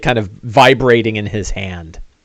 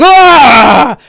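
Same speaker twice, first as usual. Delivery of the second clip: loud, high scream of burning pain; orc pain shout; 11 semitones higher, 6 dB louder than usual. Acted. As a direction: howl as if caught in fire. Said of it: "and he goes".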